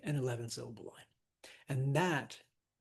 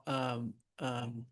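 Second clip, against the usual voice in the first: ordinary talk, nothing marked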